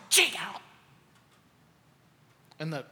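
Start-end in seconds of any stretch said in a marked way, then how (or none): 0.1-0.6 higher-pitched mock scream; a contained imitation of her screaming; retold scream. Preacher imitating the patient strapped to a gurney, mock scream. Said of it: "she's kicking and screaming and spitting".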